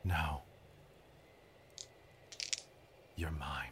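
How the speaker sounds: very low, whispered menace; possessing voice. Acted. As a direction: whisper it low, with a long pause between. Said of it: "What comes out is".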